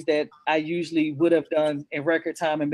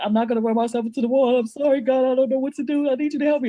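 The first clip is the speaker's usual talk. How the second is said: raised, higher pleading to God, fast at first; desperate plea to God from her car, loud and high; retold crying scream; 8 semitones higher, 4 dB louder, faster than usual. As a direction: cry out to God in desperation, replaying it. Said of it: "And I'm screaming in my car".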